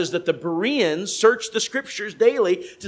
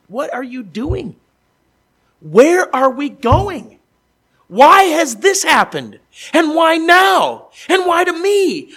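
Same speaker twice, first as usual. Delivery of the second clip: anguished cry; 9 semitones higher, 12 dB louder, slower than usual. Acted. Preacher voicing despairing believer.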